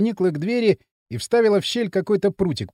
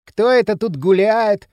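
loud, drawn-out, higher-pitched taunt; mocking; 5 semitones higher, 6 dB louder, slower than usual. A narrator's reading. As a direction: draw it out to toy with them. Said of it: "насмешливый, знакомый до отвращения голос". (насмешливо, громко, нараспев)

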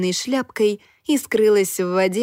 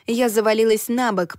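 usual speaking voice

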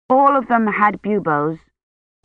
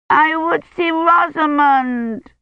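put-on high, slow tearful child wail; 9 semitones higher, slower than usual. A narrator's reading. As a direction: wail it like a crying little girl. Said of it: "she wailed".

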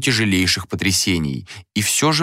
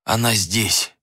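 understated exhausted rasp; spent, hoarse admission; hoarse croak; slower than usual. A narrator's reading. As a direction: croak it out with little strength. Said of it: "прохрипел Адер".

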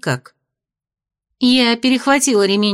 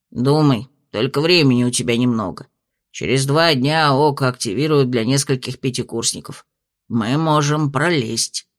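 lower, measured spirit voice; low dragon spirit voice; 8 semitones lower than usual. A narrator's reading. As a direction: speak as a wise old dragon spirit. Spirit urges.

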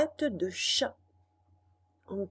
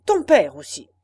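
loud echoed threat; 4 semitones lower, 12 dB louder than usual. Acted.

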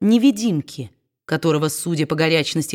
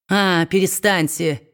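ordinary delivery, nothing marked

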